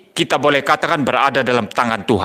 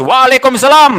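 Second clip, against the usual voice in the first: calling back; 9 semitones higher, 8 dB louder than usual. Retold or imitated. Answering the shouted greeting.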